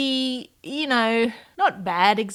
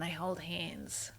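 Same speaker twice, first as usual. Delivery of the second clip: slow, soft and much quieter on hold hands; tender aside; 3 semitones lower, 15 dB quieter, slower than usual. Real, not acted. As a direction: say it gently, charmed by the image. Cute fact about otters.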